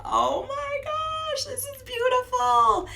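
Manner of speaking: very high, slow thrilled exclamation; delighted squeal. Retold or imitated. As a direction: gush with delight. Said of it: "And I was like".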